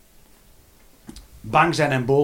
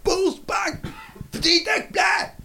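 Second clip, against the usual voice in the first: much higher, clipped words; mock high list; slower than usual. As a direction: squeak each emotion out. Listing emotions in high voice. (hoog opgesomd)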